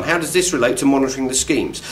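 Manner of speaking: ordinary talk, nothing marked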